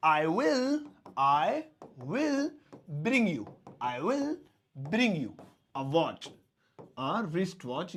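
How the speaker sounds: deliberate, drawn-out model sentence; slow demo sentence. Shown as a demonstration. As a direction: spell the phrase out slowly. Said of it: "तो कैसे बोलेंगे".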